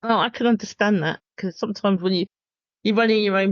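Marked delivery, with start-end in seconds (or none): none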